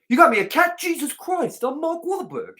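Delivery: high, hammed-up celebrity whine; mock a star bragging about himself; Mark Wahlberg.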